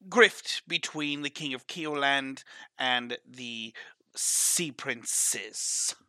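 [name]'s put-on smuggler accent, lightly held; a half-held put-on accent, smooth and forward; character accent. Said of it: "I lost my accent".

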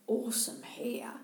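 retold whispered compliment, slow; under her breath. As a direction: breathe it out like a passing stranger. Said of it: "almost under her breath".

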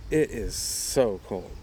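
slow, weighted understatement; emphatic complaint. Retold or imitated. Traveler to the guy next to him, slow emphatic complaint. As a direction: stretch each word out for emphasis.

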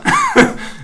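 very high short laugh; high squeal of laughter. Real, not acted. Laughing that at least one person remembers him. Laughing at his own joke.

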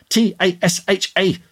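high, exasperated letter-by-letter spelling; screamed spelling. A narrator's reading. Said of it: "she screamed".

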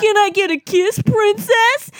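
hammed-up Link begging Zelda for a kiss; imitating Link. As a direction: beg for a kiss in a cheesy cartoon voice. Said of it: "He's just like".

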